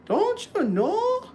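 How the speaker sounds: very high, drawn-out fake Jamaican lilt; slow, high-pitched mock Jamaican; mock Jamaican accent. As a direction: imitate her put-on West Indian accent. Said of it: "She was trying to sound like she was a Benjamite, so-called West Indian".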